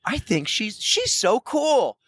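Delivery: a touch of fanboy swooning over Meg; gushing. Retold or imitated. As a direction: swoon over her a little. Gushing admiration.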